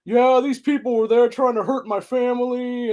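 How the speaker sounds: sarcastic high-pitched mock of the politician; mocking lying politician. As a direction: sneer it like a fake victim on TV. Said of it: "and were like".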